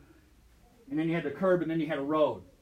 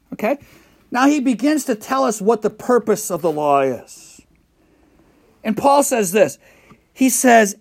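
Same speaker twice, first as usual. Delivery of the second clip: loud, fervent sermon voice, much louder; full-force preaching; 2 semitones higher, 12 dB louder, slower than usual. Acted. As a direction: preach it out to the congregation. Pastor preaching scripture loudly.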